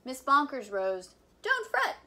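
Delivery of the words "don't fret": high, bright comforting exclamation; reassuring. Acted. Teacher reassuring the worried principal, pitched high. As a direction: brush off the worry lightly. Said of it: "she said".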